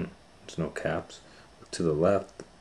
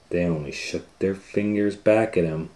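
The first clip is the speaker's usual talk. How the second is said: ordinary delivery, nothing marked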